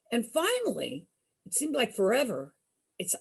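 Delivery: usual speaking voice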